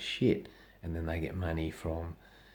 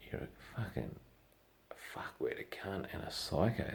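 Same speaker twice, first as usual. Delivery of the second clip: whispered insult; 7 dB quieter than usual. Real, not acted. Insulting the killer.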